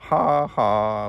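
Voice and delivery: loud, storybook "ha, ha"; laughing. A narrator's reading. Kangaroo laughs at soaked Hippo, loud spoken laugh. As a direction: read out a hearty mock laugh. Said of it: "laughed Kangaroo".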